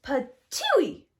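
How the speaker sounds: loud spat-out patooey; spitting patooey. Acted. Papa Bear spitting out scalding porridge, loud patooey. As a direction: spit it out with disgust.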